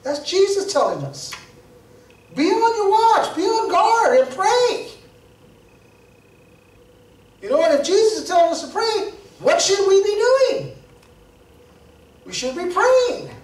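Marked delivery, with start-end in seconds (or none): 2.3-13.3 high, urgent preacher's call, slowing to drive it home; rally the church to pray; urgent, raised sermon exhortation; fervent exhortation. Acted. Exhorting the congregation.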